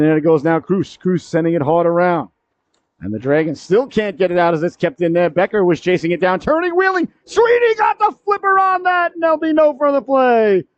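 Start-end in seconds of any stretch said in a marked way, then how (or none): 6.4-10.6 high shouted cry, coming down as play stops; excited save call. Real, not acted. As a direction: erupt at the big save, then settle. Live call of a big save.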